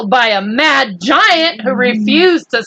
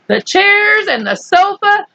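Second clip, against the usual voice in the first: ordinary delivery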